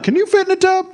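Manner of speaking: high, teasing doubtful ask; imagined audience member doubting he fits in a tub, high and teasing; mock skeptical question. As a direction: mimic a doubter in the crowd.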